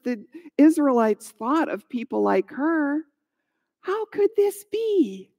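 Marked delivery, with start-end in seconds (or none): 3.8-5.3 high-pitched, wondering question; puzzled astonishment, pitched up; amazed wondering. A narrator's reading. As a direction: voice lifts in disbelief. Widow astonished by the promise.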